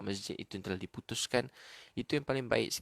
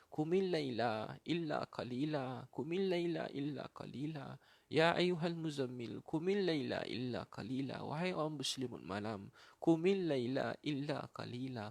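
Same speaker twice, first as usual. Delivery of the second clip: slow, higher melodic recitation; Quran recitation; 5 semitones higher, slower than usual. Real, not acted. Preacher recites the call to rise at night, melodic recitation. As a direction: lift the verse and stretch its vowels.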